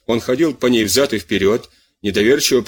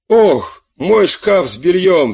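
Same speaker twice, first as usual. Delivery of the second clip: higher and louder, slow dismayed wail; dismayed cry; 6 semitones higher, 4 dB louder, much slower than usual. A narrator's reading. Farmer's wife cries out over her plundered linen, dismayed and loud. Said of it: "вскричала госпожа Бельё".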